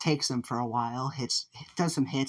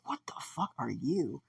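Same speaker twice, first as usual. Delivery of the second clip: half-whispered, incredulous question; hushed disbelief; 2 semitones higher, 6 dB quieter than usual. Acted. Fighter facing a weird bird creature, hushed and aghast. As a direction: drop to a soft, breath-only gasp of words.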